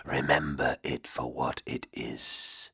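low, slow, whispery demon voice; a cold ancient demon murmuring low. Acted. Demon's parting counsel.

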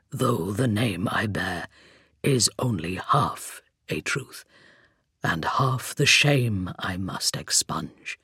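low, slow hollow whisper; a rasping voice from the grave; skeleton voice. A narrator's reading. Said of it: "the hollow timbre of his voice".

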